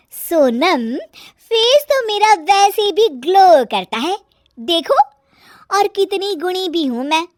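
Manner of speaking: put-on high, loud self-praising young wife; boast, then pick faults loudly; high-pitched bossy nagging; nagging daughter-in-law, boastful then scolding.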